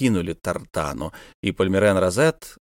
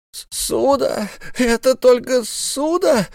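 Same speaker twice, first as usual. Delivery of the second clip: very high, slow relieved cry; delighted miser; 14 semitones higher, slower than usual. A narrator's reading. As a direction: brighten at the word loan. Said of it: "воскликнул Исаак Хакабут".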